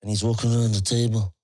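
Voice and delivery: usual speaking voice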